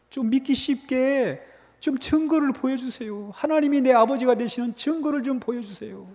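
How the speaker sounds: imitated high-pitched plea to God; mock believer's entreaty; pleading. Acting out believers begging God for proof.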